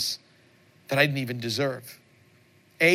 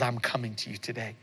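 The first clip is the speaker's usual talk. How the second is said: soft humble reply; 3 semitones lower, 6 dB quieter than usual. Retold or imitated. Voicing a believer's soft reply.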